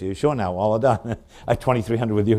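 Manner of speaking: teasing the bidder through a laugh; amused auctioneer closing the sale; laughing close. Real, not acted.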